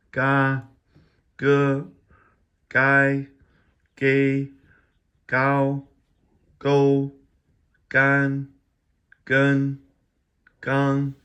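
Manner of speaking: each syllable called out loud and high, slowly spaced; drilling syllables. Shown as a demonstration. Teacher drilling Chinese initials for viewers, loud high enunciation. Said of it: "I'm going too fast".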